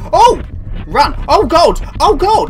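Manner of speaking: high, loud panicked shouts; real panic. Real, not acted. Gamer suddenly chased in game, shouting in panic. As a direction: cry out as if hunted. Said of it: "Sorry, I'm panicking now".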